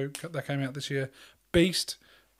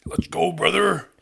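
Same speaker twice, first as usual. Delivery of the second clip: slow, loud wrestler catchphrase; Hulk Hogan; 2 semitones higher, 9 dB louder, slower than usual. Acted. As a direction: boom it out like a hyped-up wrestler. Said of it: "I'm Hulk Hogan".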